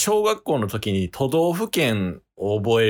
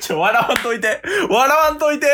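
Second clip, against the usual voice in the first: laughing plea; 3 dB louder than usual. Real, not acted. Pleading before the burp attempt.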